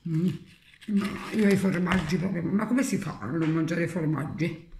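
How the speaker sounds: chewing while talking; eating cheese mid-sentence; mouth full of cheese. Real, not acted. Seen 4: Bites cheese at -2.0, chews while talking, keeps eating after.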